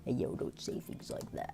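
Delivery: whispered attempt at a very deep voice. Streamer attempting a deep-voiced man, whispered imitation. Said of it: "I don't even know how to do [name]'s voice".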